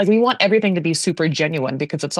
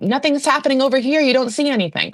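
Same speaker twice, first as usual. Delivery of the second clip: mock innocence; 7 semitones higher than usual. Voices those hiding the scaffolding.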